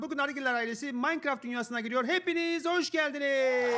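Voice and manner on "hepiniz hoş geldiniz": slow drawn-out welcome. Acted. Host welcoming viewers to video.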